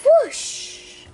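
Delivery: airy high whoosh of wind; whispered whoosh. A narrator's reading. Reading to a child, a breathy gust of wind. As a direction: blow it out like a gust.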